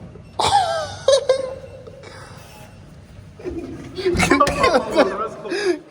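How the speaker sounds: helpless laughing fit; long high laugh. Real, not acted. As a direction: laugh until it squeaks. Laughing at 'maa ka pati'. (ज़ोर से लंबी हँसी)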